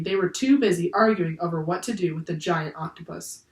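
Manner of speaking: usual speaking voice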